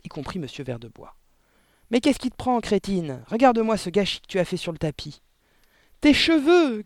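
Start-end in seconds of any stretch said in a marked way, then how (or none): 1.9-5.2 raised, louder voice of a cross husband; irritated scolding. Acted. Father scolds mother for the mess. 6.0-6.8 high, loud horrified cry; shrieked yelp. A narrator's reading. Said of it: "glapit la mère".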